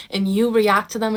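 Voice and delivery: ordinary talk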